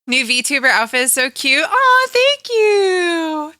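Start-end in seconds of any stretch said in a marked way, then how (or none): 1.7-3.5 touched aw. Real, not acted. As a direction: melt at the compliment. Touched by a compliment.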